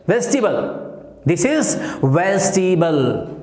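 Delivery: "vegetable" as VEJ-ti-bul, slowly spaced; "vegetable" without the "table" sound; correct pronunciation. Shown as a demonstration. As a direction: stretch out a model "vegetable", three syllables. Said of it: "टेबल नहीं".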